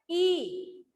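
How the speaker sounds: "ई" held long; long ee vowel. Shown as a demonstration. Teacher showing students the vowel's sound, long-drawn "ई". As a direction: stretch out the sound "ई". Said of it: "लंबा होता है ना".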